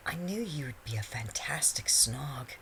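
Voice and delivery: slow, husky, breathy whisper. A narrator's reading. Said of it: "she whispered huskily".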